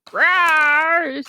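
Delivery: playful roaring growl; dragon roar. Retold or imitated. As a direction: roar like a monster. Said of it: "being like".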